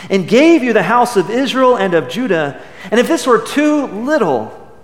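forceful, solemn Bible reading; scripture reading. Acted. Pastor reading Nathan's rebuke to the congregation, solemn and forceful. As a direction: proclaim the prophet's words to the king.

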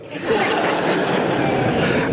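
airy, very high laugh; breathless squeal of laughter; high giggle. Real, not acted. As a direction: let the laugh escape. Laughing at story's end.

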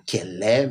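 "λέ" with a soft, fluffy Cypriot λ. Retold or imitated. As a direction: say "λέ" with a softened, rounded Cypriot λ. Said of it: "αφραταμένο το λάμδα".

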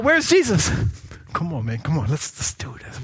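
ordinary talk

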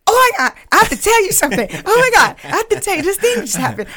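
high, loud, fast gush; so excited. Retold or imitated. Telling Locke what just happened, re-enacted excitedly. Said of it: "I was so excited".

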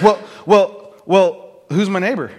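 loud, stalling "well, well, well" before the question; buy time like a cornered lawyer; cornered lawyer hedging loudly; evasive stalling. Acted. Lawyer dodging Jesus's point.

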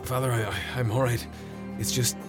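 weak and pained. Acted. Wounded monk struggling to speak.

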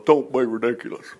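low, soft put-on dismissal; Saul's muttered dismissal. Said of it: "no wonder Saul goes".

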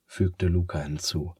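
plain reading voice

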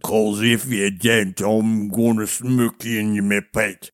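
low, slow, slurred giant; menacing giant. A narrator's reading. Giant lifting friar off the ground, menacing giant. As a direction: slur the threat, low and slow. Giant threatens, interpreter must translate.

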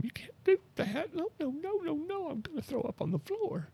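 very high squeaky panic voice, far above normal; panicked Ed. Retold or imitated. Comedian voicing his terrified friend Ed in a very high squeal. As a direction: squeal it like a terrified henpecked husband. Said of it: "I can hear him".

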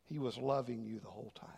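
preacher whispering God's love; tender whisper. Real, not acted. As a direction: breathe it tenderly to the listener.

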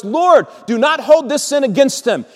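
high, loud plea; loud cry. Acted. Dying Stephen pleads for his killers, crying out loudly. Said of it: "cried out with a loud voice".

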